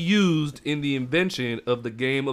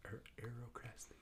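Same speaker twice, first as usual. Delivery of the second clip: whisper; 4 semitones lower, 31 dB quieter than usual. Real, not acted. Struggling to pronounce a word.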